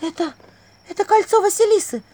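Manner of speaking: high, halting gasp of recognition; stammer, then blurt it high in shock; shocked stage whisper. A narrator's reading. Vasilisa recognising the ring, shocked exclamation. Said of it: "прошептала она".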